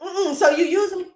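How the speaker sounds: mock-hurt, accusing; hammed-up wounded lover, quick and high; offended boyfriend. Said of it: "He's like".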